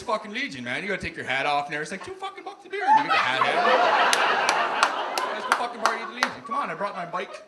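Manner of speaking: excitable, high Northern Ontario hoser voice; Northern Ontario accent. Acted. Local talks a pal into the Legion, eager Northern Ontario accent. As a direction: pitch a night out, voice leaping, Northern Ontario accent. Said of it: "We got the worst accent".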